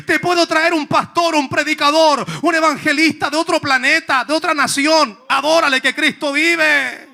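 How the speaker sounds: loud, high, driving sermon voice; full-force preaching. Acted. Preacher drives the congregation, loud and high.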